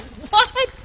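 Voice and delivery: high, loud mock disbelief; shocked. Father retelling his shock at his son's outburst. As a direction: act stunned and outraged. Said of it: "And I was like".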